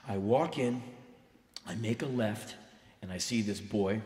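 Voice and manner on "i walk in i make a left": ordinary talk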